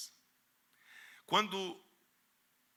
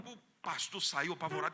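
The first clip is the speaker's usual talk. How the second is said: usual speaking voice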